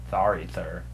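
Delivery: low, soft lisped "Sorry, sir"; wrong kind of lisp. Shown as a demonstration. Comic showing what he didn't mean, low soft lisp. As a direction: drop low and lisp it softly. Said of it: "not like a".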